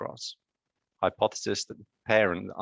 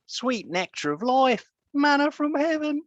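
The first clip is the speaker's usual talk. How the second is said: high, drawn-out Australian accent, much higher and a bit louder; hammed-up Aussie, stretch the vowels and reach way up in pitch; playful over-the-top Australian accent quoting a film line; 3 dB louder than usual. Shown as a demonstration. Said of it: "Let's see if I can do a proper Aussie accent".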